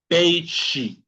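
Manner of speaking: "Peixe" said "pêi-shi". Shown as a demonstration. Teacher giving an example word, "Peixe" with a sh sound. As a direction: hush the X in "Peixe". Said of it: "el sonido del silencio".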